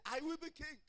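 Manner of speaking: high, loud boast as Adonijah; proud self-proclamation. Retold or imitated. Preacher quotes Adonijah exalting himself, in a loud proud proclamation. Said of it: "he spoke it. He said".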